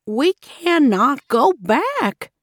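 drawn-out earnest entreaty; insistent grandmother. A narrator's reading. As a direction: explain firmly, word by word. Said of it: "Grandmother Gruff explained".

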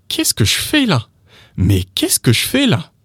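high-pitched, incredulous French question; shocked question. Shown as a demonstration. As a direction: jump up on "fais", as if asking what the hell. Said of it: "makes it shocking and surprising".

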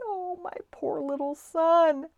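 high, plaintive mother's lament; tearful. A narrator's reading. Missus Little worrying over her lost son, tearful. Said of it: "Missus Little began to cry".

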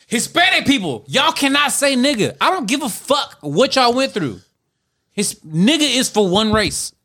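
firm, high-pitched public ruling; emphatic declaration. Real, not acted. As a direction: declare it as the final word.

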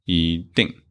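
一定 with rising 一 and falling 定; 一 in second tone. Shown as a demonstration. Teacher repeats the corrected example, 一 rising in 一定. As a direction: glide 一 upward before dropping 定. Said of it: "changed to second tone".

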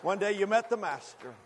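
ordinary talk, nothing marked

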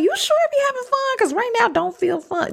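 high, fast, squeaky put-on voice; Greg's voice. Greg complaining that nothing feels fun, in a squeaky high voice. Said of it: "Greg be like".

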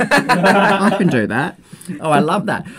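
laughing through the words. Real, not acted. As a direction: chuckle as you say it. Laughing at yoga joke.

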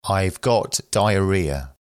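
ordinary talk, nothing marked

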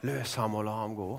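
soft low echo of the command; quiet echo. Acted. Preacher echoes the command.

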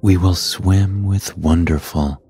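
ordinary delivery, nothing marked

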